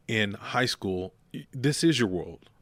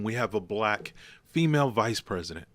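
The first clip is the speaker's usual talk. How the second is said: normal talking voice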